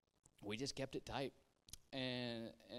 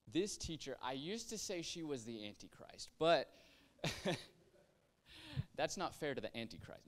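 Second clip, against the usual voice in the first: ordinary delivery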